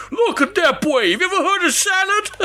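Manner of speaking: high-pitched, laughing attempt at a Chicago accent; hammed-up Chicago kayaker impression; bad Chicago accent. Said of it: "I can't do the Chicago accent".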